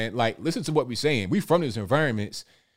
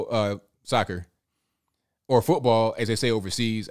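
ordinary talk, nothing marked

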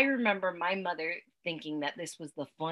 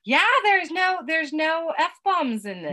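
excited; 10 semitones higher, 8 dB louder, slower than usual. Real, not acted. Excited answer about no swearing.

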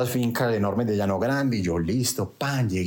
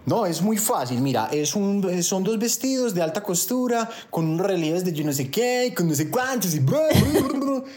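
high mock female voice rattling off details; pitched-up chatter dissolving into blah-blah; imitated woman explaining; 8 semitones higher than usual. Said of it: "Y me dice ella". (imitando a la chica explicando, voz aguda)

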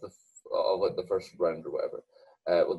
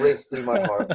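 laughing; 14 semitones higher, 4 dB louder, slower than usual. Real, not acted. Excited retelling of Euros qualification.

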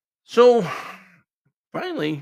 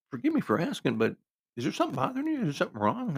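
higher, careful, concerned question; tentative concern; 7 semitones higher, 4 dB quieter than usual. Retold or imitated. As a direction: gently probe what is wrong. Tentatively asking his silent date.

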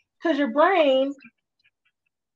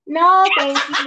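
laughing refusal; 4 semitones higher, 3 dB louder than usual. Real, not acted. Laughing refusal of a movie tip.

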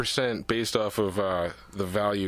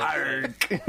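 cartoon pirate; 4 semitones higher than usual. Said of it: "I'd wanna do pirate".

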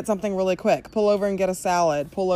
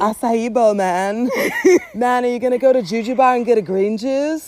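laid-back Californian surfer-dude voice; mock a chill Californian surfer, man; playful Californian surfer accent; 3 semitones higher than usual. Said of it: "we sound like surfers".

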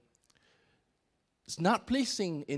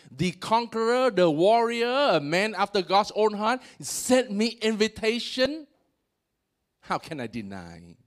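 rising, louder as she praises the king, then drops; Bathsheba's excuse; 7 semitones higher, 5 dB louder than usual. Retold or imitated. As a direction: plead like a flattered woman who could not refuse. Bathsheba voiced pleading her case.

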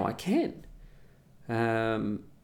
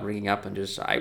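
normal talking voice

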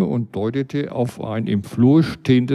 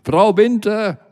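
raised, high call through the door; bellowing; 8 semitones higher than usual. Retold or imitated. Narrator bellows through the closed door for the phone lady. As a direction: call her name hard, once. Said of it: "brüllte ich erneut durch die immer noch geschlossene".

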